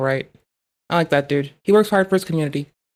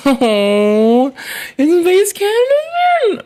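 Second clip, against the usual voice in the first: loud, drawn-out happy call after a laugh; a delighted laughing hello; excited greeting; 12 semitones higher, 4 dB louder, much slower than usual. Real, not acted. Streamer greets a favourite arrival.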